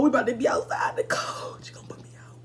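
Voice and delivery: hushed frightened whisper to her cousin; scared whisper. Retold or imitated. Whispering to her cousin in fear of being kicked out. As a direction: whisper it so mom can't hear.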